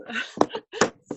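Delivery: short high giggle; bright embarrassed little laugh. Real, not acted. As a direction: laugh at yourself. Laughs after her whoops.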